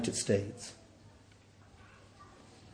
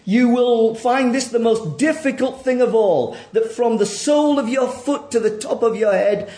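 raised, ringing sermon voice; forceful preaching; 9 semitones higher, 7 dB louder than usual. Acted. Preacher pressing the congregation to admit their sin, forceful. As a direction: press the hard truth on the congregation.

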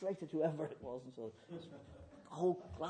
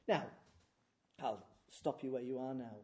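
ordinary delivery

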